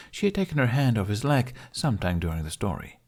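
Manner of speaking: ordinary delivery, nothing marked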